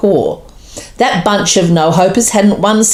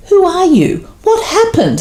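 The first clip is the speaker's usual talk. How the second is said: much higher, startled questions; aghast; 13 semitones higher than usual. A narrator's reading. Reindeer aghast at the glowing team. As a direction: gasp it out in disbelief. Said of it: "all aghast".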